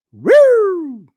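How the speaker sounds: loud, high siren whoop; imitated police siren. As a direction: mimic a police car's siren burst. Imitates police cars arriving suddenly.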